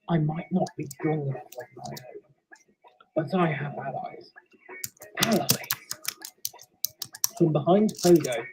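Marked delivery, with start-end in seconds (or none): 0.1-5.7 put-on quiet ghost confronting an old enemy; soft ghostly voice. Ghost Pogo speaks to Repulse.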